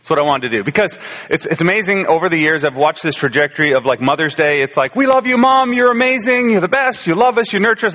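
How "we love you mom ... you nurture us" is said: gushing praise. Retold or imitated. Mocking gushing Mother's Day praise.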